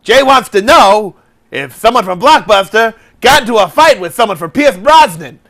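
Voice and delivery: high, loud, swooping quiz host; mock game show host. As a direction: read it out like a hammed-up TV host.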